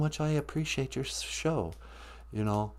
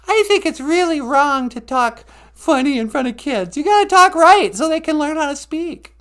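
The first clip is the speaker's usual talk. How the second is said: much higher, louder sweet wife voice; cheerful wife answering her husband; 7 dB louder than usual. Acted. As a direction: play the chirpy cohost wife calling sweetie.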